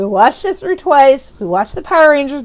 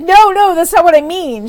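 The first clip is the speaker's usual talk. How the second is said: imitated flustered mother; 7 semitones higher, 4 dB louder than usual. Retold mother correcting her.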